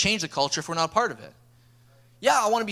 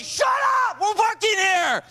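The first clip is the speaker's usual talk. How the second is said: much higher shouted scolding, slowing at the end; mock shout; 4 dB louder, slower than usual. Coach shouts kids down to focus, mock yell. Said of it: "I didn't actually say that".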